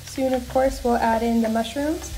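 ordinary delivery

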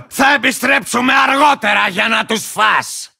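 belligerent, unhinged warrior taunting his enemies; cantankerous lizard rider. Acted. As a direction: rant like a crazed, land-guarding rider. Belligerent game character taunting enemies.

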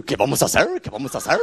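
cartoon dog voice dubbed in Spanish; Scooby-Doo. Cartoon dog dubbed for the kids, goofy voice. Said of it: "They're watching Scooby-Doo".

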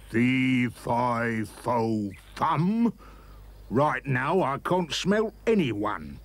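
loud, slow giant chant; loud muttering giant. Acted. Giant finding no scent, loud slow grumble. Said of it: "muttering loud".

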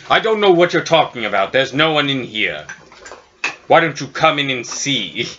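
put-on voice daring them in; giantess feigning innocence. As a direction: call out innocently through the boulder. Giantess lying to the thane.

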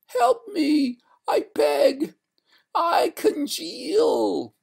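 very high, loud wail; beg, drawing out the last words; tearful ghost. A narrator's reading. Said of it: "a tear trickling down her frozen cheek".

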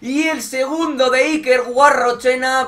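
rising to a roaring goal call; breakneck goal commentary. Acted. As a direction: shout the second goal. (narración de gol a gritos)

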